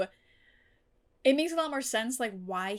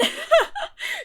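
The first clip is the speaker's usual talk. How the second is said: squealing high burst of laughter; high loud laugh; 10 dB louder than usual. Real, not acted. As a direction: let out a shrieking laugh. Reaction to show twist.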